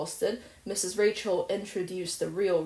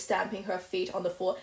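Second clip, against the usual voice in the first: usual speaking voice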